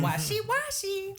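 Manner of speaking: high, slow singsong imitation; buffet greeter's singsong. Sash-wearing greeter to buffet guests, high slow singsong. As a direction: stretch it out in a very high chirp. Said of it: "she says it just like that".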